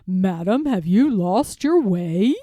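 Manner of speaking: smooth, slow, courteous fox voice; a fox being oily-polite to a duck; sly fox gentleman. Acted. Fox gentleman greeting Jemima, smooth and sly.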